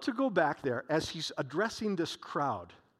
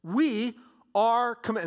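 "We are comm-" slowed right down and pressed; a weighty, drawn-out "We are comm-"; slow emphasis; 5 semitones higher, 6 dB louder, much slower than usual. Acted. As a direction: lean hard on each word. Preacher stresses the command.

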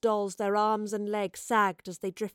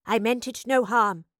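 firm, raised reply; defiant; 5 dB louder than usual. Acted. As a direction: stand your ground against it. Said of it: "she answered defiantly".

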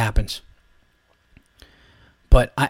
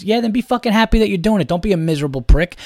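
usual speaking voice

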